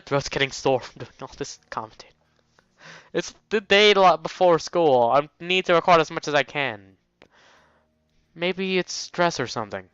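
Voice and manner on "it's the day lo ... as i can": loud, high insistence; emphatic protest. Real, not acted. Commentator insisting on recording before school, emphatic. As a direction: push it out high and forceful, then ease off. Protests at own sore throat.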